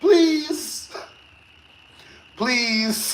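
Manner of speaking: high drawn-out plea; pleading. Real, not acted. Begging ladies in the audience, high plea. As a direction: beg them sincerely.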